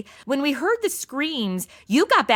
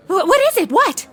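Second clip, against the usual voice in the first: alarmed; 8 semitones higher, 5 dB louder than usual. Acted. Madison reacts to the news.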